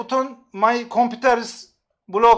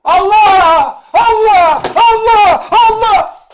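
loud, high, drawn-out Allah yelled; exasperated cries; 10 semitones higher, 11 dB louder, much slower than usual. Real, not acted. Streamer crying out in exasperation at his chat. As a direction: cry out to the heavens in exasperation.

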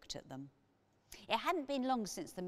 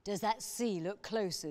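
suspicious; 3 semitones higher, 5 dB louder than usual. A narrator's reading. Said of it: "asked Sandra suspiciously".

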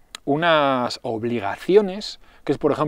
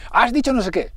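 mock accusing partner; 9 semitones higher than usual. Partner quoted in argument.

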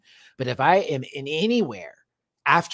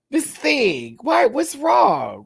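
pet talk; 6 dB louder, much slower than usual. Real, not acted. Talking to cat, high.

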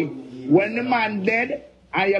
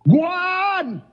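defiant yell; 10 semitones higher than usual. Real, not acted. Angry rant dares Tina.

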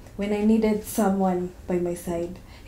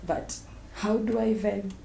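normal talking voice

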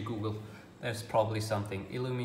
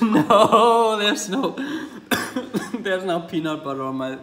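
high-pitched giggling through the words; laughing at yourself mid-sentence; laughing fit; 9 semitones higher, 10 dB louder than usual. Real, not acted. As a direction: crack up while you protest. Caught eating peanut butter, cracking up.

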